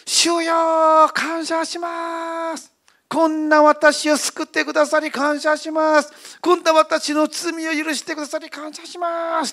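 high, loud, ecstatic praise; joyful thanks. Retold or imitated. The saved prisoner praising God, high and joyful. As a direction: burst out thanking God, overjoyed. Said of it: "救われた喜びを表し始めたの".

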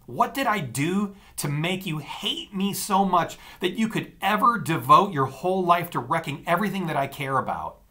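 high, loud furious rant. A narrator's reading. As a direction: rage at her, voice cracking high. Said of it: "I raved".

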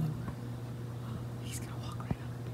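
hushed whisper. Real, not acted. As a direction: whisper so the bear can't hear. Bear approaching, speakers whispering.

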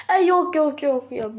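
slow, high-pitched whine; pleading. Acted. Son begs off father's belt threat, slow high pleading. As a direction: whine out drawn, pleading refusals.

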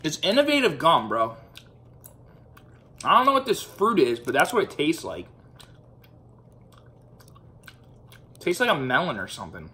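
talking around gum; chewing gum while talking. Real, not acted. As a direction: gum in mouth. Seen 4: Mouth partly hidden; he handles the gum wrapper and brings it to his mouth.

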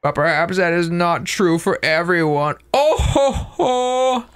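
put-on high, slow jolly old man. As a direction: chuckle like a grandfatherly hermit.

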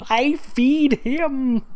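very high, slow mocking echo; squeaky mock reread of the line; mocking repeat. Shown as a demonstration.

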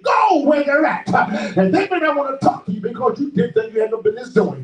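high, driving sermon cry; fervent preacher with stretched words and gasped breaks; full-force preaching. Real, not acted. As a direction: preach it out in punched, rising phrases.

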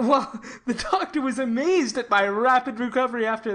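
a light laugh running through the words. Acted. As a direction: chuckle as you tell it. Laughs while telling.

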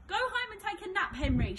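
mock him as you race past; taunting shout. A narrator's reading. Al taunting Henry while overtaking, loud high jeer. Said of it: "shouted Al".

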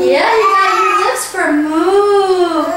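high-pitched encouraging voice; excited praise. Real, not acted. Praising the child's attempt.

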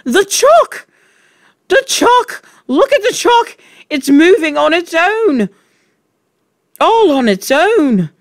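shrill, very high, loud cries; hysterical scream. A narrator's reading. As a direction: shriek it out in hysterical alarm. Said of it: "So hysterical and shrill was Nigel".

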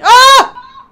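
sudden high scream of fright; startled scream. Real, not acted. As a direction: shriek as the monster attacks. Ambushed by a monster in game.

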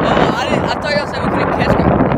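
talking loudly over strong wind; wind in the face. Real, not acted. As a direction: raise your voice against the wind. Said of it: "Sorry if it's a little windy".

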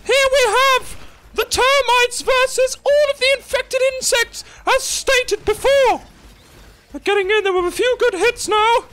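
over-the-top play-by-play for the Smurf village battle; announcer voice. Pretend. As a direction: call the bug fight like a hyped-up arena announcer. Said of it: "but in the announcer voice".

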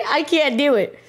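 high-pitched, giggly giving up; laughing disbelief. Real, not acted. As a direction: burst out high, breaking into laughter. Cracks up after attempting the impression.

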